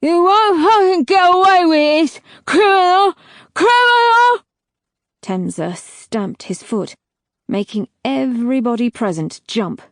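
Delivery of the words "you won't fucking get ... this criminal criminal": high, loud furious screaming; screaming in rage. A narrator's reading. Tortured Tor screaming at his captor, furious and loud. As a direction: scream the insult in agony.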